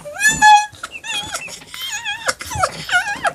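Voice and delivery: long, high helpless shriek of laughter. Real, not acted. Hearing her own punchline, shrieking with laughter. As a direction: burst out in a high squealing laugh.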